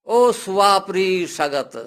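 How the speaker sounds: loud, drawn-out call for help. Acted. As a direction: call out slowly to someone far away. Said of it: "Dasharath आवाज देता है".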